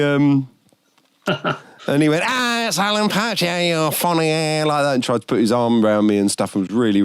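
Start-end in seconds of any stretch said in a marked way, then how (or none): 2.3-4.6 put-on Scouse accent. Drunk footballer quoted in Scouse.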